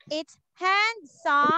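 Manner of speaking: handsome said slowly for the student; slow demo. Shown as a demonstration. Teacher slowly models handsome for Ande. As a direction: draw out handsome. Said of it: "Ande, look here".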